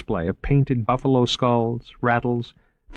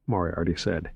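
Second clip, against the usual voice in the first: ordinary delivery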